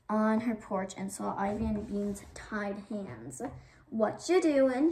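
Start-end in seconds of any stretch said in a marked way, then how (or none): 3.9-4.9 higher, louder call across the street. A narrator's reading. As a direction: call over from the porch. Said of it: "she called".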